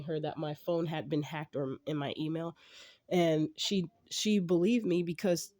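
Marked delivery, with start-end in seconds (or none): none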